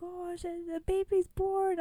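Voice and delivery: high, breathless mom; high mock emotional mom; excited mom. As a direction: relay the news crying. Mom calling crying.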